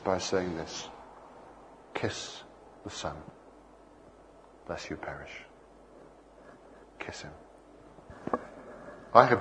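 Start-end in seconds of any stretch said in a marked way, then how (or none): 1.9-5.4 hushed scripture. Acted. Quoting Psalm two intimately. 7.0-7.3 a soft whisper. Acted. Preacher urging the congregation, in a whisper.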